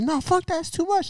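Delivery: very high-pitched mock protest; protesting girlfriend. Girlfriend after the dog was shocked, high mock protest. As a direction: protest in a high imitated woman's voice. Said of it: "She's like".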